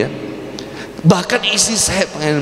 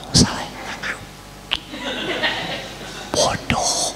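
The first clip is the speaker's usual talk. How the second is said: hushed, softer whispered scolding; whispered insult; 12 semitones higher, 9 dB quieter, slower than usual. Retold or imitated. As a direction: hiss the insult quietly in the bedroom. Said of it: "Itu bisik-bisik dan di kamar".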